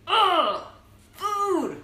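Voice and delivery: loud high exasperated groan. Real, not acted.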